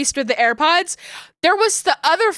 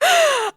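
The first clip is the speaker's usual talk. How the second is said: sharp gasp and yelp; 14 semitones higher than usual. Real, not acted. Sudden high yelp.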